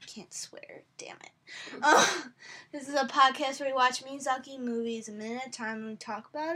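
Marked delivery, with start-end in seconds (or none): 0.1-1.3 whisper. Real, not acted. Censoring herself on podcast.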